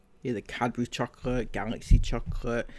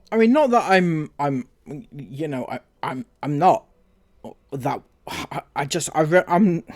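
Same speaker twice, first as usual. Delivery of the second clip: loud, stumbling backpedal; flustered and louder, words falling over each other; flustered protest; 3 semitones higher, 7 dB louder than usual. Real, not acted. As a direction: splutter loudly through broken false starts. Flustered protest about seeing bras.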